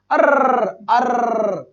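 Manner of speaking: "अर्र" with a long rolling R; sustained trill ending "अर्र"; trilled R sound. Shown as a demonstration. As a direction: roll out "अर्र" like a string of firecrackers. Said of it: "ये अर्र की sound होती है".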